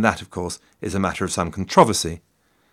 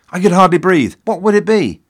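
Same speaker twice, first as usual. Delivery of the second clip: high, louder burst of anticipation; mock suspense; 8 semitones higher, 5 dB louder than usual. Podcaster retelling a tense radio moment, in mock suspense. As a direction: act out breathless anticipation, pitched high. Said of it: "The tension mounted as it became clear".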